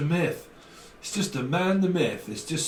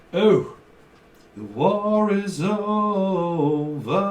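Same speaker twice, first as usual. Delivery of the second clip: dramatic proclamation; 5 semitones higher, 4 dB louder, much slower than usual. Pretend.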